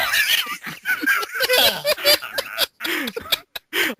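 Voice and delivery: high, loud burst of laughter; cracking up at own slip; loud shrieking laugh. Real, not acted.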